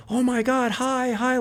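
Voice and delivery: high-pitched imitation of a gushing fan; excited fan. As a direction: mimic a stranger thrilled to spot a star. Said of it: "like that".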